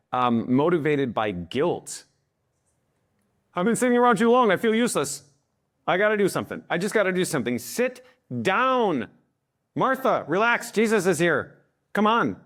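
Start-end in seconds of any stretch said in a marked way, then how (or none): 3.5-7.6 high, restless guilt-ridden voice; guilty fretting. Acted. Conscientious person who feels useless sitting around, fretting. 7.6-12.4 high-pitched, exasperated plea to relax; exasperated reassurance. Acted. Telling busy Martha that Jesus is here, exasperated.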